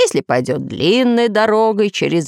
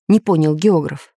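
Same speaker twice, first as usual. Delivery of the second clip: ordinary delivery, nothing marked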